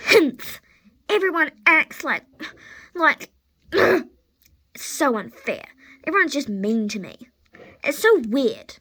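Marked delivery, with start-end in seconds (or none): 0.1-0.6 loud, huffy "humph"; indignant humph. Acted. Horse left out of the treats, indignant humph. 1.1-4.1 loud, high, halting grumble; huffy complaint. Acted. Horse sulks that everyone is mean, huffy.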